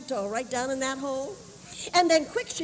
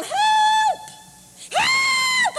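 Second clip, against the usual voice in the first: high, loud cry for help; yelling for help; 8 dB louder than usual. Acted. The leaven in the hot oven screaming for help. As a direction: scream it out like you're burning alive. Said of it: "began to yell".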